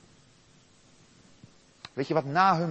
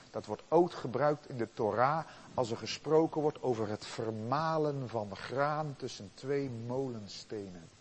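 ordinary delivery

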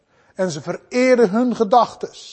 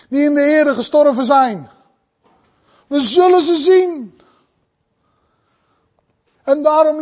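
emphatic, raised sermon delivery; impassioned preaching; 4 semitones higher than usual. Real, not acted. Preacher urging brothers and sisters, impassioned. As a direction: preach it out with conviction.

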